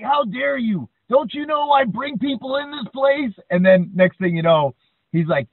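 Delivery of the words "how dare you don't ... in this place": much higher indignant wrestler bluster. Retold or imitated. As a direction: puff up like an insulted wrestling star.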